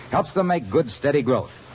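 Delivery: ordinary talk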